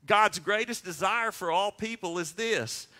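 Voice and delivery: normal talking voice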